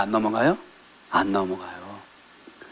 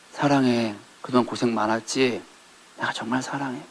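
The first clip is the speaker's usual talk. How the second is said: tender husband; 2 semitones lower, 9 dB quieter than usual. Retold or imitated.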